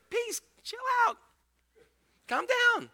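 high-pitched, hammed-up soothing; calming Jesus.

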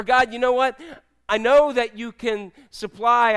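loud, emphatic address to God; fervent declaration. Acted. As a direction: insist on it forcefully, stretching the words. Acted prayer declaring faith in provision.

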